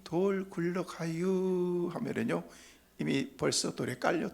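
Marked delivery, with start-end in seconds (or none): 0.0-1.9 very slow, stretched out, as if there's all the time in the world; slow Chungcheong drawl. Retold or imitated. Son warning his father about the rock, very slow Chungcheong speech. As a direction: drag each syllable out lazily. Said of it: "말도 느리다고 그러는데".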